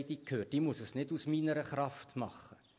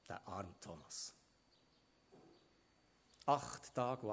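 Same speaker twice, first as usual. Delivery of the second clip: mournful doubt; 6 semitones lower, 7 dB quieter than usual. Acted. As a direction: let the eight days weigh on it. Thomas' eight days of doubt. (leise, langsam, bedrückt)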